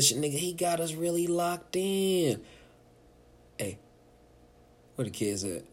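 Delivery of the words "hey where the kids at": quiet question. Pretend. Parent checking on quiet kids.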